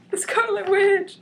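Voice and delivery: high loud laughing cry of the name; excited announcement with laugh. Acted. Reader hyping the heroine's entrance, laughing shout. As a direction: shout her name with glee. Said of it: "He didn't say that, I did".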